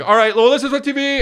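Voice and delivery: mock panic, pitched very high; imagined panicked dad. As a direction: act out losing your cool in front of your kid. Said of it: "turn on the lights and being like".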